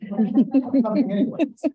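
loud, laughing and defiant; laughing defiance. Retold or imitated. Old nurse brushing off her doctors, laughing loudly. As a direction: laugh it out, full of cheek.

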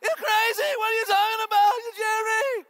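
very high, loud sitcom-style outburst; hammed-up comic yelling at the top of the range; Jerry Seinfeld. Preacher joking about how he sounds, hammed-up sitcom shouting. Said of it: "it's just Jerry Seinfeld the whole time".

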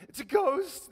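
high frightened cry of ghost; a disciple shrieks in fear, high and loud; scared cry. A narrator's reading. Disciples terrified on the lake, high scared cry. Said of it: "cried out in fear".

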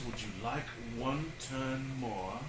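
hushed, tender little question; soft gentle question. Acted. Dad voicing the polite dinosaur, soft and gentle. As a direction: ask it softly like a kind dinosaur. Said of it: "then asks".

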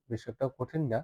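ordinary talk, nothing marked

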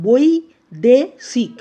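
বৈদেশিক loud and slow, boi, de, shik apart; syllable by syllable. Shown as a demonstration. Teacher asking students to watch the pronunciation, syllable by syllable. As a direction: punch out each syllable of বৈদেশিক with pauses. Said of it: "প্রথমে উচ্চারণ করছি".

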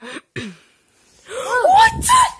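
sudden high startled cry. Real, not acted. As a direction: caught off guard, blurting out. Brother wakes, startled.

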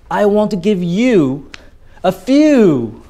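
you and few drawn out, high and slow; prolonged key words. Shown as a demonstration. Teacher emphasizing keywords to students, you and few held long. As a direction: stretch you, pause, then stretch few. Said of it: "I will elongate, prolong the words".

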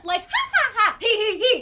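haughty staged ha-ha-he-he laugh; a lofty, put-on theatrical laugh; superior laugh. Shown as a demonstration. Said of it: "working on a superior laugh".

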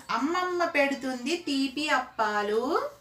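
slow, measured rhyme line; children's poem read out slowly; singsong rhyme. Acted. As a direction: recite it with drawn-out line endings.

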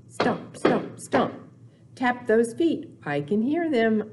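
usual speaking voice

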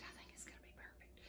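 barely there whisper, fast; fast faint whisper. Real, not acted. Cook repeating the promise to viewers, faint whisper. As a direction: hush it almost to nothing.

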